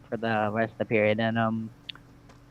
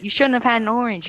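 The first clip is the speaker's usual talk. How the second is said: scolding teacher; 9 semitones higher than usual. Retold or imitated. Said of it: "she's like".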